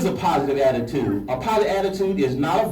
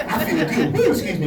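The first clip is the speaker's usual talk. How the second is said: usual speaking voice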